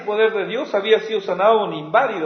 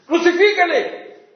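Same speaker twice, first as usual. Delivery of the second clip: much higher, louder furious cry; crowd shout; 9 semitones higher, 5 dB louder than usual. Retold or imitated. The crowd shouting against Jesus, loud and higher. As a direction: hurl it like a mob. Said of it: "qué es lo que grita".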